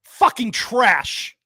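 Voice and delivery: real frustration. Real, not acted. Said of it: "I'm getting so angry".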